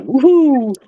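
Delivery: ordinary delivery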